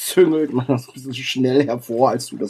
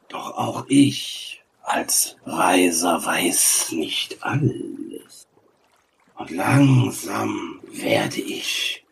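slow, drawn-out, partly whispered; weary ancient sage; 3 semitones lower, slower than usual. Acted. Sage grows tired of the travellers, slow and weary. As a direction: stretch the words, then rise in impatience. Game master voices the sage.

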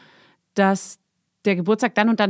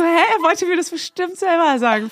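puzzled; 7 semitones higher than usual. Retold or imitated. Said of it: "Er, er so".

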